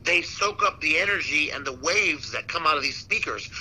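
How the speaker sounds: ordinary delivery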